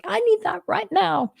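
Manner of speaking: high, pleading outburst; exclaimed self-quote. Retold or imitated. Telling listeners why she thanks the fairies, high exclamation. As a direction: blurt it high, as an urgent need. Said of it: "because I'm like".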